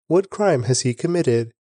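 ordinary delivery, nothing marked

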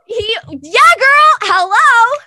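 gleeful confirmation to her friend; excited yes. Real, not acted. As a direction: squeal the answer with delight. Excited reply to friend's guess.

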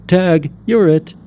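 put-on high, loud boy's shout, drawn out; little boy yelling tag; playful shout. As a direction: call it out gleefully to the other kids while running off. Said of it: "Edward shouted as he ran away".

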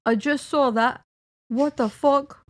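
mock impression of the co-host; Adam. Reading co-host's texts back to him, bad impression. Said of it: "Adam voice".

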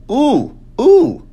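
high, loud squeal of delight; excited ooh. Real, not acted. Vlogger spots a photo, squealing excitedly.

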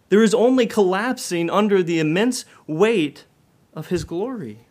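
preacher driving the point home; fiery preaching. Acted. Preacher to congregation, preaching with rising force.

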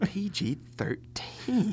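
slow, drawn-out whisper; hushed. Retold or imitated. Answering the young customer at the movies, slow mock whisper. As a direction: whisper it slowly like a dubious secret. Acted hushed reply in quote.

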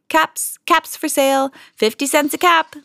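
high, sing-out peddler's cry; street vendor's call. A narrator's reading. Peddler to townsfolk on the street, calling out his caps. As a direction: call your wares out, high and sustained. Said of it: "walked back to town calling".

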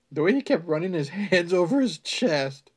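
loud, cracking up mid-line; laughing while reading. Real, not acted. Reader cracking up at Paul's chest, laughing. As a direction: read it while losing it.

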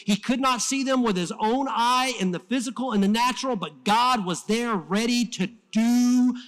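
high, rising pulpit fervor; impassioned preaching. Real, not acted. Preacher telling of chariots of fire surrounding the enemy, impassioned. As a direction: build the story to a triumphant peak.

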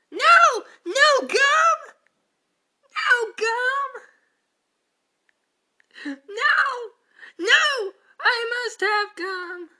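high, wailing cries of despair; anguished wailing. Acted. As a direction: wail like the world is ending. Despair over no gum.

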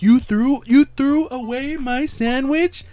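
high, loud, drawn-out mock outrage; outraged yelling. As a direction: act out an incredulous yell, stretching the words. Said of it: "he was started yelling".

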